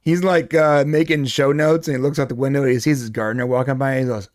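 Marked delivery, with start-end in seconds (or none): none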